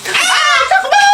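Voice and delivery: shrill panicked yell to run; panicked scream. Acted. Caught, running away.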